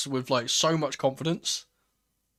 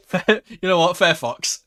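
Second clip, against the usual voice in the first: excited; 4 semitones higher, 8 dB louder, slower than usual. Real, not acted. Fan reacting to goal, laughing.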